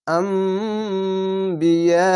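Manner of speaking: আম্বিয়া chanted very slowly, the vowel held four alifs; long madd lazim. Shown as a demonstration. Teacher chants a long madd for Quran pupils. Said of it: "এই মিমকে উচ্চারণ করতে হবে".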